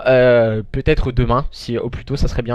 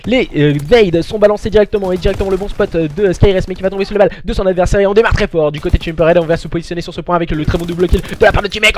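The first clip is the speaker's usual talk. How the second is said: play-by-play commentary; 3 semitones higher than usual. Real, not acted. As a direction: call the match live like a caster. Live esports play-by-play.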